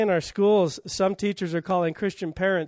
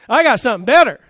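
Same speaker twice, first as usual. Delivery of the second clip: high, loud triumphant proclamation; booming divine answer; 5 semitones higher, 10 dB louder, faster than usual. Acted. Preacher repeats God's answer to immorality, loud and triumphant. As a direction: punch it out loud, voice raised high on it.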